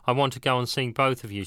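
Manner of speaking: ordinary delivery, nothing marked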